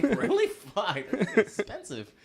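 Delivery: high, slow mock shock; surprised dismay. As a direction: sound stunned at the price. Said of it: "I'm like".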